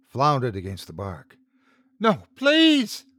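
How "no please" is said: put-on high, loud, terrified shriek of a girl. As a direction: scream it out in panic. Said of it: "she shrieked".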